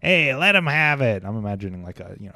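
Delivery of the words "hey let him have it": loud, drawn-out mobster command; bad mobster accent, higher and slow. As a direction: call the order like a hammed-up gangster. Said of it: "stereotypical mobster".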